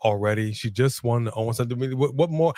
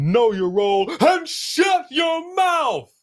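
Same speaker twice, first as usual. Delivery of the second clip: commanding shout; 13 semitones higher, slower than usual. Retold or imitated. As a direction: bark it like an order. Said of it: "She basically said".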